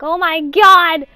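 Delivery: loud, drawn-out horrified scream. Retold or imitated. Sibling seeing blood on brother's head, loud horrified scream. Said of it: "I scream so loud".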